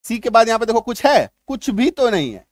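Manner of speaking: ordinary talk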